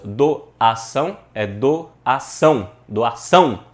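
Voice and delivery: "doação" drawn out in slow separate syllables; testing the stressed syllable. Shown as a demonstration. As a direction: try "doação" piece by piece to find its stress. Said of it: "qual que é a sílaba tônica nossa?".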